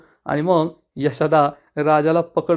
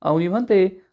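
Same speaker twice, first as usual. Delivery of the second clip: ordinary delivery, nothing marked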